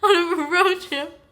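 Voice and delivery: put-on high, halting, laughing boyfriend voice; nervous boyfriend. Imitating her boyfriend nervously asking her on a trip, high and halting. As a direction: stammer it out like you're nervous. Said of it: "he's like".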